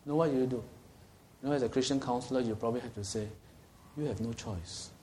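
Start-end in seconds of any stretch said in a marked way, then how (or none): 4.0-4.9 gentle. Acted. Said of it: "In a gentle way lah".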